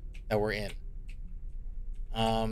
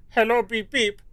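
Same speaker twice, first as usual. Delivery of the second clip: high, louder playful beeping voice; silly put-on voice; 6 semitones higher, 5 dB louder than usual. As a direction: chirp it loudly, high and silly. Playing a silly voice in game.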